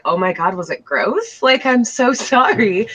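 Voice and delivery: high, laughing apology, slowed down; mock panicked apology. Retelling her reaction to his compliment, laughing mock panic. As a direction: imitate your flustered self, laughing.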